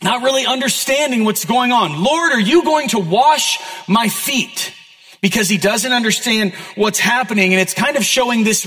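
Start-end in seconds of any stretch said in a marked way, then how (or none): 2.0-4.7 slow, raised, indignant emphasis; a little angry. Acted. Peter not understanding Jesus, slow, high and a bit angry. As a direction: punch the words in disbelief and irritation. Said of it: "being a little bit angry".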